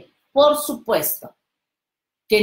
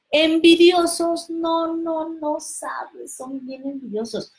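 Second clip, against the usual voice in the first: emphatic; 8 semitones higher than usual. Real, not acted. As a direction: burst out on envidiosos. Enfatiza con fuerza. (exclamación enfática que se apaga)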